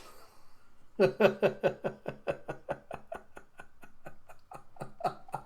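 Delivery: long, helpless giggling; real laughing fit. Real, not acted. Reader cracks up at the manga fight, long laughter.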